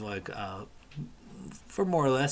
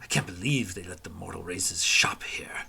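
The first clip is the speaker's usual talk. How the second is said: snobby whisper; 3 semitones lower than usual. Acted. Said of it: "murmurs and whispers of".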